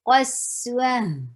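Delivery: قسوة with a short clipped a. Shown as a demonstration. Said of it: "a short".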